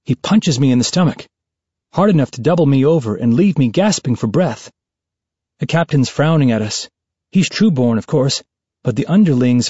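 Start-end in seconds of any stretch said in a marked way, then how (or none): none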